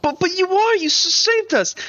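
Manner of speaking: put-on high, stammering kid voice; insistent child. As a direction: protest like an admiring little kid.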